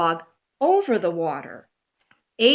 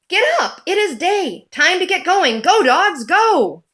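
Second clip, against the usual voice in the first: much higher, bright rallying call; rousing wake-up call; 8 semitones higher, 3 dB louder than usual. Acted. Calling every dog to get up, high and rousing. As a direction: rouse the sleepy dogs.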